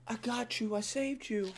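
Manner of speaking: lightly voiced hammy superhero; soft hero voice. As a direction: breathe it out like a cheesy hero. Voicing the hero on screen.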